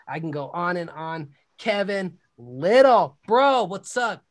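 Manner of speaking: loud, pumped announcer voice; host introducing his guest, loud announcer; hype host intro. Acted. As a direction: bring the guest on like a hype man.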